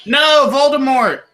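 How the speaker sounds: emphatic shout. Real, not acted. Insisting on joke answer.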